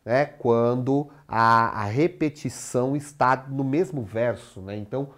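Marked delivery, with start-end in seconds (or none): none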